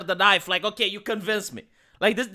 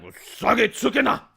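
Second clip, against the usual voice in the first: loud, dramatic samurai shout of the name; samurai movie style; 4 dB louder than usual. Shown as a demonstration. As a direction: shout "Usagi Tsukina!" like a warrior's battle call. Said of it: "like it's a samurai movie".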